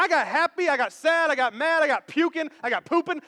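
ordinary talk, nothing marked

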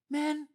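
high, punched "Man"; "Man" thrown out as a protest; emphatic MAN. Acted. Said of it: "I love how he puts man in there".

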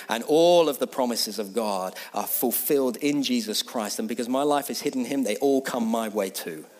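normal talking voice

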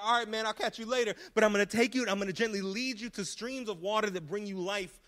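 loud, high preaching build; full-force preaching. Acted.

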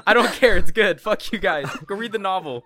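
loud, laughing, defiant; defiant shout. Real, not acted. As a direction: shout it back at them playfully. Defiant outburst at teasing friends.

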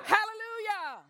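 high, loud hallelujah; joyful shout. Real, not acted. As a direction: shout it out with praise. Shouted praise response.

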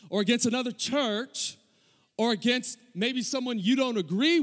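high-pitched, urgent exhortation; impassioned preaching. Real, not acted. Preacher warning church members against violent rhetoric, impassioned preaching. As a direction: press each clause home to the crowd.

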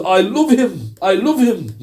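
a spirit speaking through the man, pleading; jinn voice. Retold or imitated. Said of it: "a voice came out of the man".

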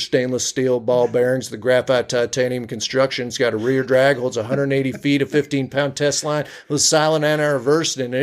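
rattled-off gear list in a folksy Utah accent; a Spanish Fork fisherman bragging; Southern Utah County accent. Shown as a demonstration. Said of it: "if you're in Spanish Fork".

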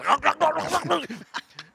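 high mock gobbling sound; hammed-up cat eating noise. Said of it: "and it goes".

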